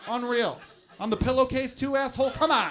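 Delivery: loud, high, indignant ranting; over-the-top outrage at a stain; exasperated rant. Acted. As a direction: chew it out like a mess you found. Ranting at the load.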